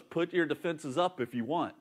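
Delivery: quiet, menacing Jesus. Retold or imitated. Jesus warning the church, low and menacing. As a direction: speak it like a quiet blade. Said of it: "And Jesus goes".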